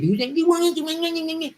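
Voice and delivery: mocking whiny voice. Mocking the all-caps user.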